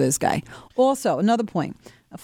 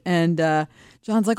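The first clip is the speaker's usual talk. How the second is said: normal talking voice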